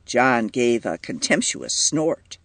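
ordinary delivery, nothing marked

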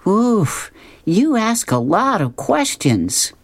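put-on high, slow, exasperated girl voice; Luann's voice. Luann teasing Tara, high and slow. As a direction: sigh it out at a nosy friend. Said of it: "Luann says".

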